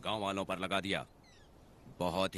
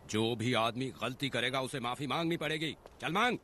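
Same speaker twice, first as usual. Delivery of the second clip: rising higher to a forceful command; angry demand; 4 semitones higher than usual. Acted. Villager orders the wrongdoer to beg forgiveness, angrily. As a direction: build up to a high-pitched order.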